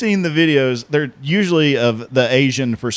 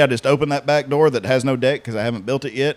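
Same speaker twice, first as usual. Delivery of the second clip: normal talking voice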